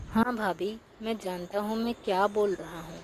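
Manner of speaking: usual speaking voice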